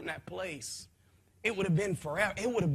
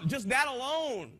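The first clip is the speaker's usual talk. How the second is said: ordinary talk, nothing marked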